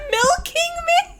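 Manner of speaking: can't believe what you just heard; gasping in disbelief; incredulous laugh. Real, not acted. Incredulous at misheard lyric.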